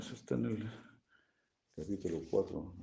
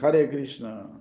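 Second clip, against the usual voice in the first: louder, higher call of Hare Krishna; crying out; 5 semitones higher, 8 dB louder, slower than usual. Shown as a demonstration. Teacher showing how to cry out to the holy name, loud. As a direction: call out the name like a plea for rescue. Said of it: "cuando tú gritas".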